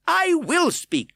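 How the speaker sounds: very high, loud, slow defiance; insist, crying out; defiant cry. A narrator's reading. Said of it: "cried the man".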